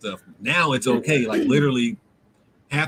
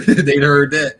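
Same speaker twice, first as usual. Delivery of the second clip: louder, slow, mock-alarmed aside; loud joking; 2 semitones higher, 10 dB louder, slower than usual. Real, not acted. Joking reaction after laugh.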